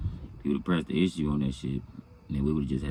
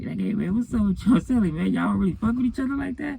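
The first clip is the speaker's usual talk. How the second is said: pitched way up, teasing question; high nosy celly. Retold or imitated. Cellmate prodding him in the day room, high mocking voice. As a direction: squeak it out high like a prying cellmate.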